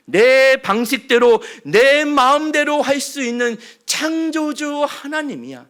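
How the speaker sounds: loud, emphatic sovereign declaration; God's declaration. Acted. God declares his sovereignty to Moses, loud and emphatic. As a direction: stamp each word with authority. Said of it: "뭐라고 대답하시죠?".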